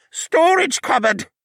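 high, loud, slow call; a trapped man yelling his location; loud, high cry from behind a door; muffled shout. Acted. Trapped man calls back.

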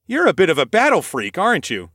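teasing with a smile; amused teasing. Acted. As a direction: tease him fondly. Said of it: "the amusement in my voice".

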